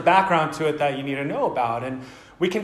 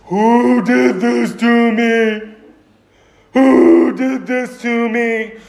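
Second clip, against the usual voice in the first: slow, loud, high bellow of a hurt giant; blinded Cyclops; 4 semitones higher, 6 dB louder, much slower than usual. Retold or imitated. Blinded Cyclops crying out to anyone nearby, slow loud bellow. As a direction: wail it out slowly, like a wounded monster. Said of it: "he's sitting here going".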